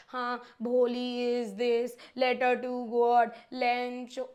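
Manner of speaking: slow, droning mock of a student rereading by rote; mindless rote reading. Teacher mocks a student rereading answers, slow droning imitation. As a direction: drag it out like a bored kid parroting answers.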